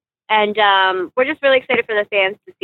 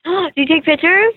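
gasping, higher and excited; surprised gasp; 6 semitones higher than usual. Real, not acted. Excited gasp at being seen.

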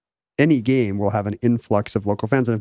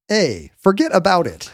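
put-on slow, higher New York accent; New Yorker catchphrase; 6 semitones higher, slower than usual. Transplant showing off the catchphrase, mock New York accent. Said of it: "well-mannered ones immediately start saying".

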